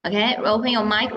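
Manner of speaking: ordinary talk, nothing marked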